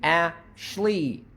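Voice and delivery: "Ashley" broken as "A", gap, "shlee"; split before sh. Shown as a demonstration. Teacher testing if sh moves syllable, "A-shley". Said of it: "sh is gonna move to the next syllable".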